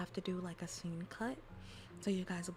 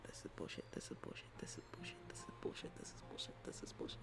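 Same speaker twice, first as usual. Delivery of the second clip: repeated frustrated whisper; 9 dB quieter, faster than usual. Real, not acted. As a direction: whisper it over and over, stung.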